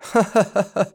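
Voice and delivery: high, loud hysterical cackle; hysterical laugh. Acted. The man laughing madly at Jackie's name, hysterical cackle. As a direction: burst into a crazed high laugh. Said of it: "بهستيريا ضحك الرجل".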